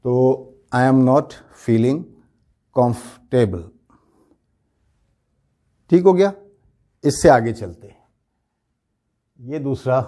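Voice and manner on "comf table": "comfortable" as comf-table; correct pronunciation. Shown as a demonstration. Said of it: "بولتے وقت یہ لفظ جو ہو جائے گا". ("comfortable" کو "کامف ٹیبل" بولیں)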